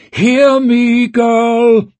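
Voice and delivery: high, loud, drawn-out holler; booming call. Acted. Witch summoning the dazed girl, booming call. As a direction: call as if from a long way off. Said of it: "voice boomed and echoed as if from a long way off".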